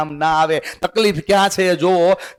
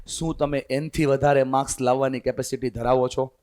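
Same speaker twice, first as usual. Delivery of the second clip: much softer, lower question; quiet first asking; 5 semitones lower, 7 dB quieter than usual. Shown as a demonstration. Teacher first asks students quietly. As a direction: ask it under your breath to the class. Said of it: "જવાબ આવે છે ને?".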